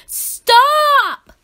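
high loud shout; cry. A narrator's reading. Splat shouting at the cats, high loud yell. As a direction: yell to make them stop. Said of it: "cried Splat".

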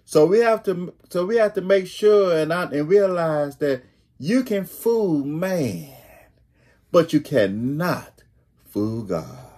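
ordinary delivery

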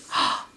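short sharp breath of alarm; gasp. A narrator's reading. Storyteller as the trouble suddenly starts, gasp. Egg's basket about to fall.

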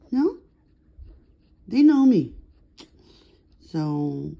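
ordinary talk, nothing marked

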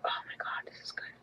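pure breathy whisper of delight; awed whisper. Real, not acted. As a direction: whisper it like a secret treat. Smelling again, whispered.

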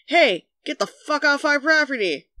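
lightly acted shouting dog; mild mock shout. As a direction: call out a territorial warning, half-hearted. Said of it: "they would be shouting outside my house".

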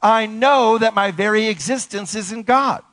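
high, ringing declaration; emphatic affirmation. Shown as a demonstration. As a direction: proclaim it to lead the congregation. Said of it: "Say it".

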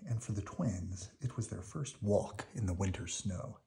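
plain reading voice

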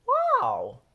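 very high, loud gasp of a wow; amazed wow. A narrator's reading. Bob amazed at Pete's painting, a loud high wow. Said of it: "says Bob".

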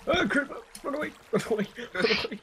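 high-pitched, rapid-fire alarm; panicked. Real, not acted. Player fleeing a creeper with a friend, panicked. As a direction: warn your partner to flee, voice shot way up.

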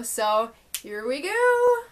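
normal talking voice

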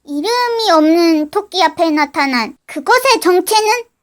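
low cliffhanger narration; narrator voice. A narrator's reading. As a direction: close the episode like a narrator.

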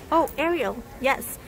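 ordinary delivery

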